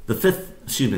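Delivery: normal talking voice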